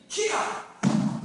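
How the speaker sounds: a full-force battle cry on the strike; spirit yell. Shown as a demonstration.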